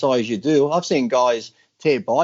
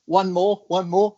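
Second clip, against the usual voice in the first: imitating girlfriend; 6 semitones higher, slower than usual.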